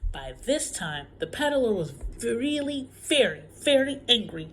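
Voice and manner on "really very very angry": "very, very angry" punched loud; emphatic. A narrator's reading. Storyteller building up the peddler's anger, loud and emphatic. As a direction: lean hard and loud on each "very".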